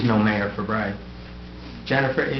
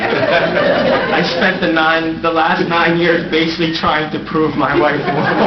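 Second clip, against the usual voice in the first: words bubbling with real laughter, louder; laughing; 4 semitones higher, 5 dB louder than usual. Real, not acted. Mayor laughing to the council about his wife, laughing. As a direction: laugh it out while confessing.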